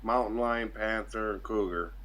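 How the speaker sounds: ordinary talk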